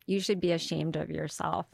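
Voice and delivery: mild deadpan scolding; imitated disapproval. Pizza customer shames comedian after seeing her show, deadpan disapproval. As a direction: shame them, matter-of-factly.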